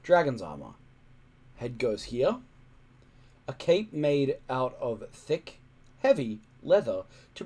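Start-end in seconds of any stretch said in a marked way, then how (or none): none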